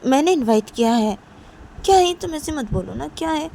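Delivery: high, pushy and defensive; exasperated. Acted. Manisha defending her invitation to Nisha, high exasperation. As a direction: brush off her anger. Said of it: "तो तभी मनीषा बोलती".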